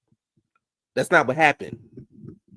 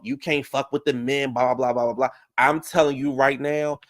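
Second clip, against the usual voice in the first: normal talking voice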